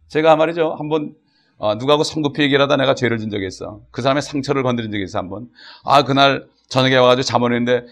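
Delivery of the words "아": ordinary talk, nothing marked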